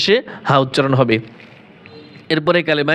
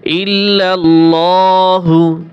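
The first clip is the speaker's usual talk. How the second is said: slow Quranic recitation; 3 dB louder, much slower than usual. Shown as a demonstration.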